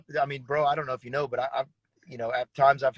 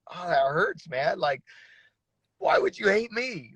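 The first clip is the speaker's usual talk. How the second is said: hurt plea; 5 semitones higher than usual. Retold or imitated. His hurt reply to friend.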